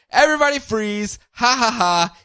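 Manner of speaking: high gleeful villain rant; evil monologue. Retold or imitated. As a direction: gloat like a cackling villain. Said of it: "Evil monologue time".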